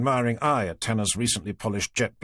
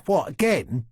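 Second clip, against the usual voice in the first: mild complaint, a bit louder; complaining; 4 semitones higher, 4 dB louder, slower than usual. A narrator's reading. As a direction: grumble it, put out. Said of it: "Townsend complained".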